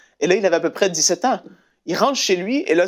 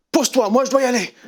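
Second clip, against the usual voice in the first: urgent mother; 8 semitones higher, faster than usual. Retold or imitated. Mother eager to go forward.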